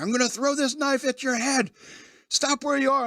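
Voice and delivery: high, strained imitation of a threat; mock threat. Wilder's character threatens to throw the knife, high mock menace. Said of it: "he's doing it by threatening to throw".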